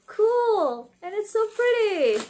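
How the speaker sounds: high, loud squeal of delight; bursting with joy over a pretty shirt; excited gushing. Real, not acted. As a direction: gush over it like a thrilled fan.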